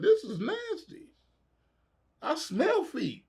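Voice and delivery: high, slow disgusted squeal. Retold or imitated. Squealing in disgust at the nasty mansion pool. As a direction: recoil at the smell, nose up. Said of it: "I was like".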